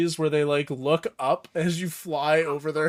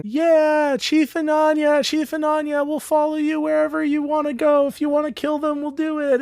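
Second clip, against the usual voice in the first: cheering crowd; 11 semitones higher than usual. Acted. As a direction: cheer your new chief on. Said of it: "They all start cheering".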